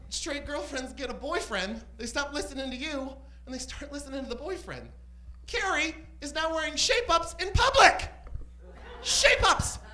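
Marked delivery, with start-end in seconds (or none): 5.5-9.8 loud, high-pitched comic indignation; mock outrage. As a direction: cry out in hammed-up horror. Mock outrage at friend's shoes.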